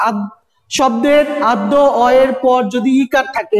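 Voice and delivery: ordinary talk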